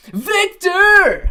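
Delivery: loud, high call of a name; excited shout. Real, not acted. Streamer shouting thanks to a donor, excited shout. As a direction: belt the name out in delight.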